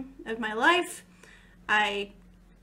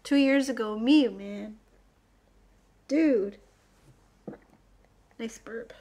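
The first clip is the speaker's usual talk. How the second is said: ordinary talk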